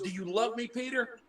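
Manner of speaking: insistent retold question.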